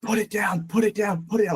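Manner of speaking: urgent, repeated order, imitating an angry guide; imitated angry yelling. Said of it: "he just starts yelling at us".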